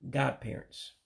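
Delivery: ordinary delivery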